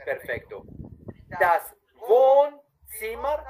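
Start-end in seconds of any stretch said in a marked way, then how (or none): 1.4-3.4 Wohnzimmer stretched out, high and loud; slow, clear model take. Shown as a demonstration. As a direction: lay out every sound slowly for the student. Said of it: "una vez bien despacito, bien claro".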